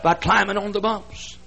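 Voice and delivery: high, loud preacher's shout; preaching at full force. Real, not acted. Preacher exhorting the congregation, shouting. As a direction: drive the point home to the crowd. Climax of sermon.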